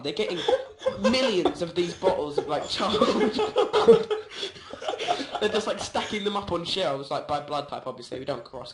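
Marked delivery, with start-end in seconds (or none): 3.4-5.3 high breathless giggle. Real, not acted. Laughing mid-sentence.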